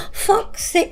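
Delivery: a touch of a bloodied fella cursing; injured guy's groan. Retold or imitated. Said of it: "this is the exact tone of voice".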